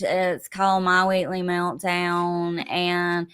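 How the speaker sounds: ordinary talk, nothing marked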